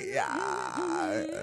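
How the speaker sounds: deep creaky fry; vocal fry. Shown as a demonstration. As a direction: drop into a low creak. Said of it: "Okay, I can do some fry".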